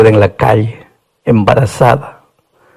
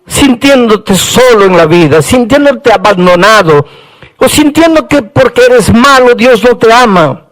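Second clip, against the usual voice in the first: full-force preaching; 8 semitones higher than usual. Acted. Preacher proclaiming God's love for his people, impassioned preaching. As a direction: preach it out, voice soaring.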